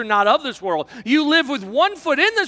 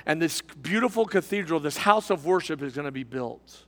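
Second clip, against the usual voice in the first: low, softer, measured awe; reverent third worker; 5 semitones lower, 3 dB quieter than usual. Acted. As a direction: let the purpose sink in quietly, with pauses. Third worker reveals his noble purpose.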